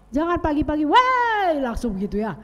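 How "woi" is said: sharp high yelled "Woi"; morning yell. Retold or imitated. Family member yelling first thing in the morning, loud rude call. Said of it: "jangan teriak dulu".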